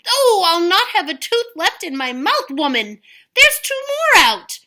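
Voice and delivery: loud, high howling complaint; hollering. Acted. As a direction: holler in pain. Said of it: "Cucullin hollered even louder".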